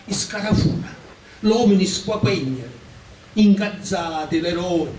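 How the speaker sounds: deliberate dialect poem reading, pitched above chat; poem recitation. Acted. Poet recites a poem written for books, in deliberate recitation. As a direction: declaim each phrase, pausing between lines. Ho scritto questa poesia.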